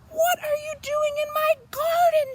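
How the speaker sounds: high, loud angry shout; shouting farmer. A narrator's reading. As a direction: yell at the sheep in your garden. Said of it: "she shouts".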